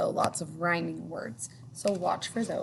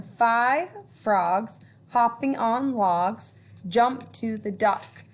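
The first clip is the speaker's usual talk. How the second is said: put-on lilting read-aloud for children; storytime reading; 3 semitones higher, 5 dB louder, slower than usual. A narrator's reading. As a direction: read it like a picture book to kids. Reading rhyming book aloud.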